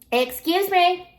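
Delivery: loud, high-pitched call. Acted. Sheep protector hails distant intruders, loud call. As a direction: holler it to get their attention.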